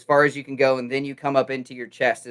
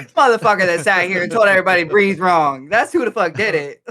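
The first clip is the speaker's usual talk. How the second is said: mock outrage; 6 semitones higher, 4 dB louder than usual. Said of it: "And I'm like".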